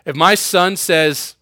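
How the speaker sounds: ordinary talk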